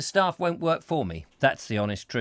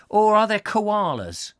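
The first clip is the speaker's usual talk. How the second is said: ordinary delivery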